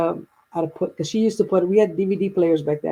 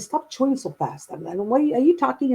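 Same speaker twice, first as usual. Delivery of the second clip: mild retold nagging, pitched up; retold scolding; 8 semitones higher than usual. Scolding mom for chewing fast.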